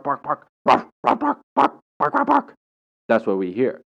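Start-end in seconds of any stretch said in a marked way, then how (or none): 0.0-2.5 high, yappy dog barks; rapid-fire barking in a squeaky pitch; imitation dog barking. Shown as a demonstration. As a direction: bark the word like an excited little dog. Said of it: "when a dog barks, we hear".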